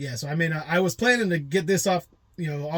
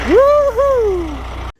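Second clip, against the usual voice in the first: a sudden high sarcastic woohoo; tongue-in-cheek celebration; mock cheer; 11 dB louder than usual. Real, not acted. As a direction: cheer like it's great news when it isn't. Sarcastic cheer about being late.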